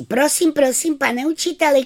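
put-on girl reporting to the teacher; tattling schoolgirl. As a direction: tell on him to teacher. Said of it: "hned hlásí".

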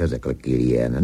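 usual speaking voice

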